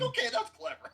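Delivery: high, laughing through the words; delighted giggly reply; laughing quote. Retold or imitated. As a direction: crack up while saying it. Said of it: "I was like".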